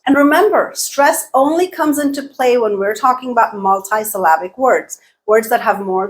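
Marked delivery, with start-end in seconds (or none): none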